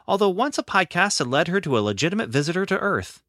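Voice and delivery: ordinary delivery, nothing marked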